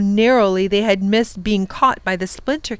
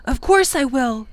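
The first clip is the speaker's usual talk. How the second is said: brave; 6 semitones higher, 4 dB louder than usual. Acted. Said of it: "said Tom bravely".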